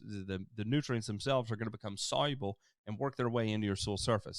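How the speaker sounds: normal talking voice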